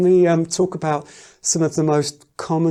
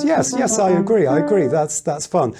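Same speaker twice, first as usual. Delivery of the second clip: excitable voice; 2 semitones higher than usual. Acted. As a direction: play an over-keen person agreeing. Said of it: "is an excitable voice".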